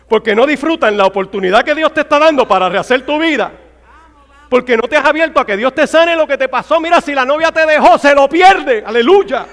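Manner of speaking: loud, high-pitched, exhorting sermon voice; fiery preaching. Real, not acted. Preacher challenging the congregation to rebuild their lives, fiery. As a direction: preach it hard, rising to a shouted climax.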